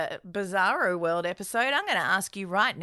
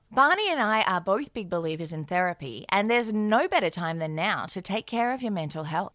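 ad read; 4 semitones lower than usual. Acted. BetterHelp ad read by both.

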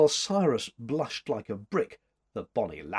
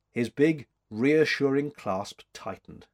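ordinary delivery

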